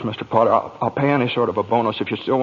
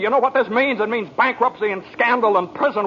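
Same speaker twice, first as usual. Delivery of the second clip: panicked fury at his uncle over the lost money; furious tirade; 7 semitones higher than usual. Acted. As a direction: lash out at the old man in a high, hard voice. George blows up at Uncle Billy.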